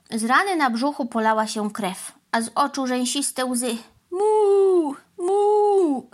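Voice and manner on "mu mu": high, loud mournful moo; a hurt cow lowing loudly. A narrator's reading. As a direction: bellow a pained cow's moo. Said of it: "ryczała mama Mu".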